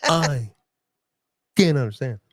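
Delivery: halting starship captain, big pauses; Captain Kirk. Acted. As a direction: break every phrase off dramatically, word by word. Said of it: "say that in the Captain Kirk voice".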